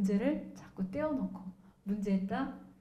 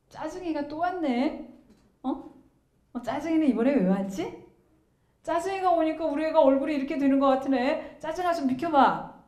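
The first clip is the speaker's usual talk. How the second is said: lightly acted parent, higher and playful; parent naming irritation; 4 semitones higher, 5 dB louder than usual. Parent talking to child about visiting irritation, lightly playful. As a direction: chat to irritation like a visitor, pitched up.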